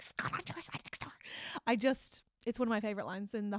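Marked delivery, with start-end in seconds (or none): 0.0-1.1 hissed stage whisper; acted-out frantic whisper; urgent mock whisper. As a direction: whisper it urgently, as if someone might overhear. Same line re-enacted as urgent whisper.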